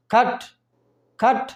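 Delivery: खट said with a clipped half ट at the end. Shown as a demonstration. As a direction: stop खट short on the ट. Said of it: "ट पूरा नहीं है".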